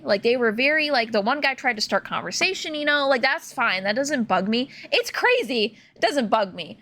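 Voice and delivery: ordinary talk, nothing marked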